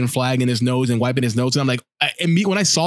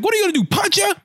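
much higher, hammed-up taunting voice; mock wrestler taunt; faster than usual. Wrestler taunting his partner over the lady, in a much higher mock voice. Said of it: "then Bubba's like".

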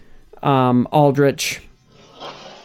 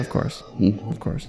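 whispered; 5 semitones lower, 9 dB quieter than usual. Real, not acted. Agreeing in a hushed aside.

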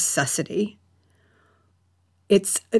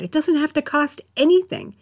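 higher, stress on anything; more emphatic; 9 semitones higher, faster than usual. Real, not acted. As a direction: push anything up high. Emphatic reassurance.

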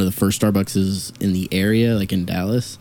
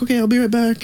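high bright "I'll be right back"; chirpy, upbeat promise; cheerful promise; 14 semitones higher than usual. Retold or imitated. Speaker tells the man he'll be right back, high and cheery. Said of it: "and I was like".

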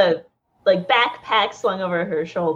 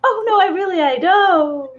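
nervous; 10 semitones higher, 3 dB louder than usual. Acted. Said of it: "nervous to be back in the city".

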